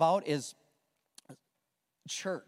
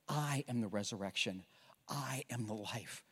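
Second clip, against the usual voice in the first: quiet Jesus; 7 semitones lower, 9 dB quieter than usual. Acted. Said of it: "He says".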